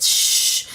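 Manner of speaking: SH as a long, voiceless hush; hushing sound. Shown as a demonstration. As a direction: draw out SH like shushing someone. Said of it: "telling somebody to be quiet".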